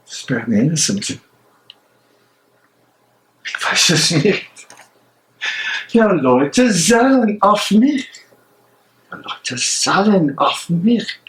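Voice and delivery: tormented man arguing with himself alone; a conscience breaking under doubt; anguished soliloquy. Acted. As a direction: pace and wrestle with your own guilt aloud. Bonhoeffer's anguished prison monologue.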